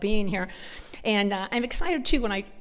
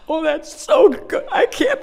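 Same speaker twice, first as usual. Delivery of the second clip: high, loud, squealing delight; delighted gush; 7 semitones higher, 8 dB louder than usual. Retold or imitated. Lady gushing over the word care, squealing with delight. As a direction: gush like it's the best thing ever.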